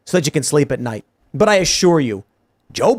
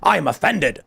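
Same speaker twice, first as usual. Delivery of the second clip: a hint of an indignant British official, pitched up; mock offended crown; 3 semitones higher than usual. Pirates' government mocked as huffy and offended. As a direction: play the innocent, hammed-up outrage. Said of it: "It's like".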